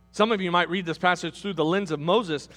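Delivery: usual speaking voice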